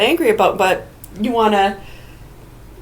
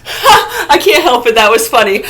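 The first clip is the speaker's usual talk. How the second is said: laughing helplessly; 4 semitones higher, 6 dB louder than usual. Acted. Person scolded in a library, talking through giggles. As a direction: fight to talk while laughing.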